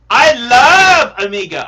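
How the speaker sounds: I love Omega belted out loud; loud declaration. Real, not acted. Streamer to his viewers, a loud excited declaration. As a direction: proclaim it to the whole stream.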